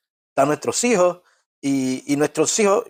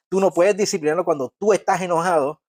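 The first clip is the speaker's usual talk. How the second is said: ordinary delivery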